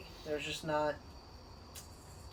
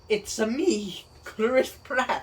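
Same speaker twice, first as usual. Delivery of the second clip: Mario voice; 6 semitones higher, 5 dB louder, much slower than usual. Retold or imitated. Mario impression repeated.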